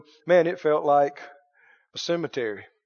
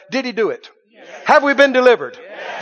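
usual speaking voice

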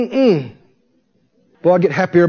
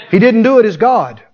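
normal talking voice

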